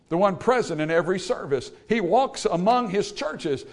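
rising from firm preaching into a high, loud, slowed cry; building sermon. Acted. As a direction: climb into full-force preaching. Preaching builds to full force.

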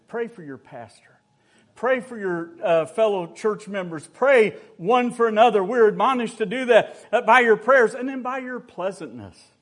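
loud, high preaching push; fervent preaching. Acted. Preacher exhorting members to pray for each other, loud. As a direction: drive the call to prayer home.

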